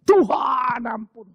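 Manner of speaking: slow, sobbing cry for mercy; tearful shout for mercy. Acted. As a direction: wail it as a broken king begging forgiveness. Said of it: "baru dia nangis teriak".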